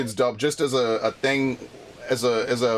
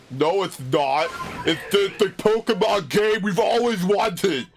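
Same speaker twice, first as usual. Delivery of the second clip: much higher, slow whiny mock voice; mocking fans; 7 semitones higher, slower than usual. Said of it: "you all sound like a bunch of fucking idiots".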